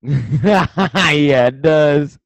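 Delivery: loud, high, laughing outburst; laughing agreement. Real, not acted. Co-host cracks up at a lookalike photo, laughing loudly. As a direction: burst out laughing into the words.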